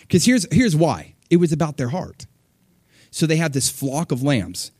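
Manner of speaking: ordinary talk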